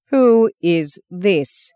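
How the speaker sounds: very slow, clipped disdainful snap; nun snaps at the boy about his sister; snapped question. A narrator's reading. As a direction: snap it out with disgust. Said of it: "she snapped to Bob".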